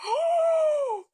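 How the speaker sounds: sharp high startled "Oh"; startled gasp. Retold or imitated. Spotting her ex walking out of the gym, startled. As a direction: jolt of surprise.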